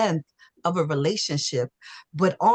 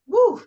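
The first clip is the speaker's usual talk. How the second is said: high emotional woo; shaky sigh; 4 dB louder than usual. Real, not acted. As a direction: let out a teary whoo. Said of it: "I'm trying not to cry".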